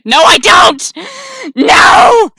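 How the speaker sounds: loud, high-pitched denial; over-the-top protesting yell; indignant shout. Acted. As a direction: yell back at the game in outrage.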